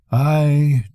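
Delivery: ordinary delivery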